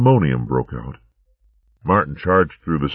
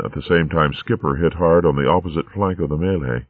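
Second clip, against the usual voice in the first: ordinary delivery, nothing marked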